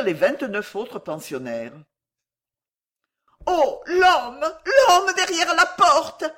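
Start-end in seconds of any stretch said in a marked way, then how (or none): 3.5-6.2 high, loud, drawn-out scream of fright; terrified cry. A narrator's reading. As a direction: cry out in broken terror. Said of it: "cria Miss Smithers d'une voix entrecoupée".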